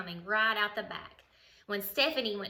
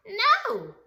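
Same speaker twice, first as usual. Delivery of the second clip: sharp refusal; 12 semitones higher, 6 dB louder than usual. Acted. Stephanie refuses mom.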